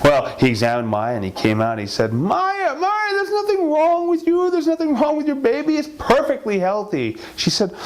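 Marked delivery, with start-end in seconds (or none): 2.1-7.2 doctor's soothing lie. Acted. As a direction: pitch up and smother her calm. Doctor falsely reassures Maya.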